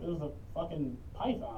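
ordinary talk

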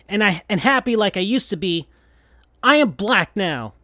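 still angry. Acted. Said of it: "sounding still angry".

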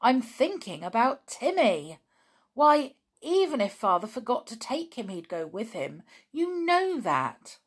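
loud, worried insistence; crying out. A narrator's reading. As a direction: protest with rising, emphatic pitch. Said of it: "cried George".

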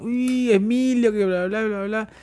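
high, slow starstruck mother; gushing mother. Retold or imitated. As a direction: gush like a starstruck mom, drawn out. Mother gushing over celebrity. (madre encantada, aguda y lenta)

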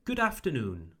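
"Good afternoon" with short vowel in Good. Shown as a demonstration. Teacher models the fix for learners, short oo in "Good". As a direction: say "Good afternoon" with a quick English "Good". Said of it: "make it shorter".

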